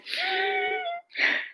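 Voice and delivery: sour lemon gasp. Acted. Eating a lemon.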